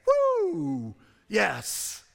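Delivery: a sudden high, loud whoop of joy; excited whoop. Real, not acted. Preacher cheering the centurion's confession to his congregation, excited whoop. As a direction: throw out a joyful cheer.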